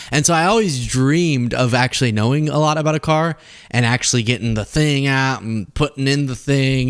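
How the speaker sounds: usual speaking voice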